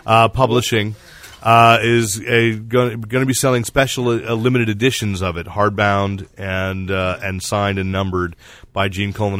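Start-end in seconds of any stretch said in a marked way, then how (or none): none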